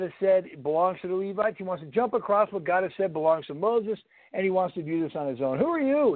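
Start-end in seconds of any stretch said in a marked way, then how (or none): 5.6-6.1 a touch higher, louder mock challenge; Korah's challenge. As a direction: throw the question back at Moses in defiance. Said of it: "he says".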